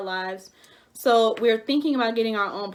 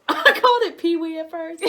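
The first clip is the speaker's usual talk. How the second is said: laughing; 7 semitones higher, 4 dB louder than usual. Real, not acted. Laughing at own mispronunciation.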